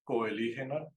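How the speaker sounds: "coelígena" with a hard k at the start; mistaken pronunciation. Shown as a demonstration. Bird teacher recalling their old mistake, mistaken pronunciation. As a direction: say "coelígena" as ko-e-LI-hena. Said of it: "yo al principio decía".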